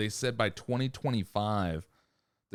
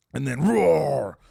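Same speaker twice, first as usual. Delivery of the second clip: imitated roar; 5 semitones higher, 8 dB louder, slower than usual.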